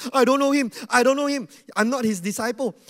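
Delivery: loud, high cursing denial; swearing denial. Retold or imitated. Peter cursing and denying Jesus, loud swearing. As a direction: spit out the denial with curses. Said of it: "started to swear and say".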